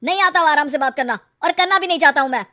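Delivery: loud, fast, furious retort; heated husband firing words out; angry. Acted. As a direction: snap back loudly, refusing to calm down. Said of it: "दुकान जाने का दुख गुस्से में बदल गया था".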